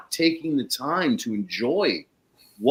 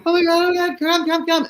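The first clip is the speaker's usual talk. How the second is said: much higher, louder excited call; screaming; 5 dB louder, faster than usual. Retold or imitated. Jeannie calling her neighbour over, imitated excited scream. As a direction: shriek to the neighbour to come over. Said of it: "she starts screaming".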